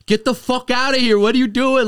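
high, loud outraged shout to get out; angry yell. Retold or imitated. Screaming at the intruder. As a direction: caught naked, yelling at his friend to get out, furious. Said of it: "He's like".

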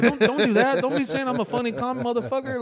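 high, loud frantic pleading; panicked inner plea. Retold or imitated. Comic silently begging the opener, high panicky plea. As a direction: beg in a panic, high and fast.

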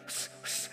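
imitated Lamaze breaths, pushed out as shushes; labour breathing shushes. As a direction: puff out two sharp shushes.

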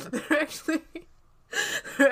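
laughing. Real, not acted. Laughs through the restart.

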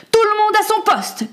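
high-pitched captain's call; shouted order. Acted. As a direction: call all hands to their posts. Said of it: "cria le capitaine".

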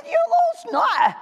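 high, slow playful scold; affectionate fake-stern scold; mock scold. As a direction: tease the toddler back. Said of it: "And I said".

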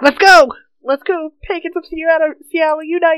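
excited rally cry, high and loud; rallying cheer. Retold or imitated. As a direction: cheer them on like a crowd. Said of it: "they're just gonna be like".

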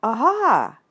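loud, high Aha!. Shown as a demonstration. Rabbit explaining the signal, loud. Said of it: "a loud".

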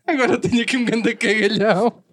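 giggling, words breaking up; barely getting it out for laughing; laughing through words. Real, not acted.

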